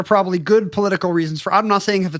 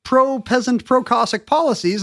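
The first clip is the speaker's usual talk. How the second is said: high, emphatic tsar voice; mock tsar's declaration; 7 semitones higher than usual. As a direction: pitch it up and proclaim. Tsar quoted.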